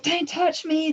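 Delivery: high, startled cry of don't touch me; panicked plea. Retold or imitated. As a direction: recoil with a sharp, high plea. Retelling her own panicked reaction.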